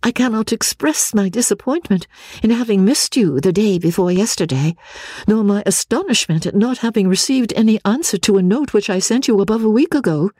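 high, reproachful letter voice; disappointed Marianne letter. Acted. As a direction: read it as a let-down correspondent. Reads Marianne's second letter.

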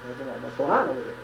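usual speaking voice

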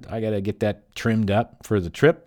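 ordinary delivery, nothing marked

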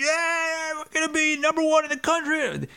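high, excited player shouting; excited yelling. Retold or imitated. Player yelling after the win, high and excited. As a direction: whoop like a celebrating player. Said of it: "he's yelling, he's laughing".

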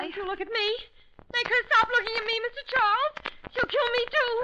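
high, pleading panic; frightened. Acted. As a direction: beg him, terrified she'll strike. Scared wife begs detective.

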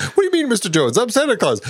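squeaky, high innocent Santa; high-pitched Santa voice. Retold or imitated. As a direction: play dumb in a high squeak. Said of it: "He's like".

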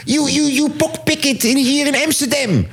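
high-pitched mock accusation; Boevenspotter. Thief spotter accusing a pickpocket on camera, high mocking impression. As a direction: imitate an overeager snitch in halting English, voice far up. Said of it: "En dan zegt hij".

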